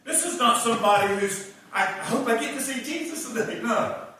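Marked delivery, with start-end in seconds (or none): 1.7-3.6 lightly higher, sugary meek tone; a hint of a sweet Sunday-school girl; mock meek woman at the well; meek little voice. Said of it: "she doesn't have a meek little voice".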